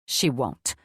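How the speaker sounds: short and low, a hint of curtness; terse. A narrator's reading. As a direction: cut it off tersely. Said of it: "Dragomir tersely replied".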